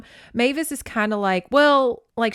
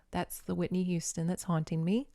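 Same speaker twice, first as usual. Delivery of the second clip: quiet, hushed quote of Mavis; half-whispered, a bit awed; hushed retelling; 2 semitones lower, 9 dB quieter than usual. Retold or imitated. Mavis nervously confiding to friends, hushed.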